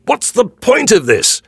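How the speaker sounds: high, loud, slowed outcry; young man shouting at the onlookers. A narrator's reading. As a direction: rouse the crowd with a desperate shout. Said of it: "he shouts at the people".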